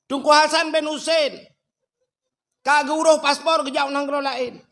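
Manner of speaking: high, loud mosque announcement; over a PA system. Retold or imitated. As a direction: call it out like a loudspeaker notice. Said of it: "Bengah ku pegah pengumuman li ku imum".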